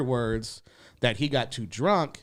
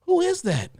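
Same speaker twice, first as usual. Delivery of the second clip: much higher, stunned disbelief; shocked. Retold or imitated. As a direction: react in shock to the heckler. Shocked reaction on stage.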